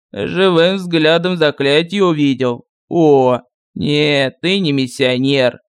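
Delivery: put-on low, slow, grumbling old sorcerer; lightly voiced village sorcerer; gruff sorcerer voice. A narrator's reading. Sorcerer voice held. (ворчливый голос колдуна)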